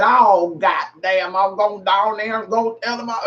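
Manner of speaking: slurred mock Southern drawl; imitated Southern accent. Educated attorney slipping into Southern talk, imitated Southern accent. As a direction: run it together in a thick Southern accent. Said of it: "we sound stupid as fuck down here".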